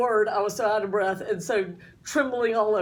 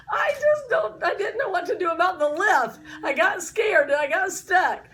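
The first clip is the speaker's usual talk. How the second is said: laughing shout; 6 semitones higher than usual. Retold or imitated. Lisa explaining the lift scared her, a high laughing shout. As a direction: blurt it out, half laughing.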